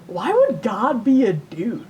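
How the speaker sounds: normal talking voice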